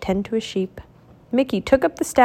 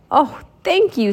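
smiling thanks; 7 semitones higher than usual. A narrator's reading. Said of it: "smiled the old man".

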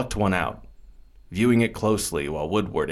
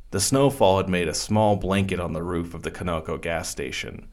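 usual speaking voice

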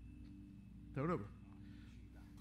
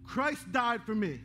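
preaching at full force; 6 semitones higher than usual. Acted. Preacher rousing the congregation to build on God, full-force preaching. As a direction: preach it out, rising and pressing hard.

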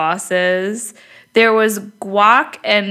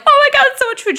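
amazed exclamation; 4 dB louder, much faster than usual. Retold or imitated. Retold laughing over too much food.

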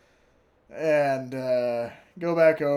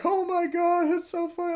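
much higher, mock girly squeal; mocking giggly girl; 5 dB louder than usual. Mocking the friend who laughs at his puke-soaked clothes. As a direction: parody her giggling delight.

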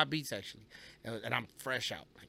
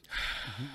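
long, audible heavy sigh out; 4 dB quieter than usual. Retold or imitated. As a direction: breathe it all out slowly to hold your temper. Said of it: "Deep breaths".

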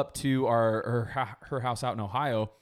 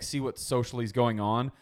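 normal talking voice

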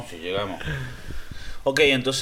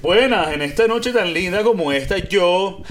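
higher, louder mock Venezuelan greeting; Venezuelan accent; 6 semitones higher, 6 dB louder, slower than usual. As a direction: croon it like a Venezuelan charmer. Answering in Venezuelan voice.

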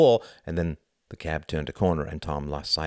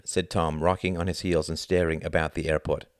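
ordinary delivery

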